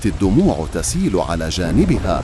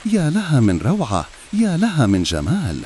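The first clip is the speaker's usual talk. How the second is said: higher-pitched narrated wonder; lightly acted awe at a lovely sight; amazed; 7 semitones higher than usual. A narrator's reading. Cracked jar marvelling at roadside flowers, amazed. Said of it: "فشهقت الجرة المتشققة بدهشة".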